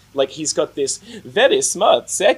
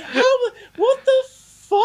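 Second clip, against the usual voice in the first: laughing disbelief; slower than usual. Real, not acted. Incredulous outburst at film.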